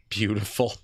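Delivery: ordinary delivery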